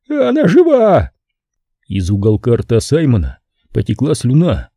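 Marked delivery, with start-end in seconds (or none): 0.1-1.1 high, strangled scream of terror; terrified shriek. Acted. A pimp with a gun at his head shrieking in terror. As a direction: squeal it out in sheer horror. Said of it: "завизжал от ужаса и сдавленно выкрикнул".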